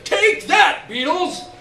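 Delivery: put-on boy's triumphant shout as he throws the bag; battle cry shout. A narrator's reading. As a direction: hurl it at them like a battle cry. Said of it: "was the intended message".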